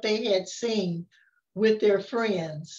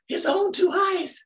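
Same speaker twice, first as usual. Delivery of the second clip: astonished echo; 9 semitones higher than usual. Acted. Story crowd repeating the kid's words, astonished. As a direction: gasp the words out in disbelief. Crowd echoing the kid, amazed.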